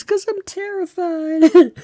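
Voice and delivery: high, slow, frightened mother; terrified motherly voice. Retold or imitated. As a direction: wail it like a scared mom. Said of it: "This is just my terrified and my motherly voice".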